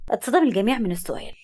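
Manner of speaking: ordinary delivery, nothing marked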